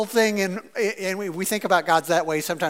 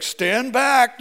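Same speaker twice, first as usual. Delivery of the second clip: Buzz bravado; 5 semitones higher, 4 dB louder than usual. Retold or imitated. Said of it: "he's like".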